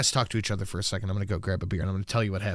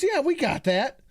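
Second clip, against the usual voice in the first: higher, lightly voiced cashier lady; a light high imitation of the cashier; 14 semitones higher than usual. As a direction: mimic the chirpy worker behind the counter. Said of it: "She goes".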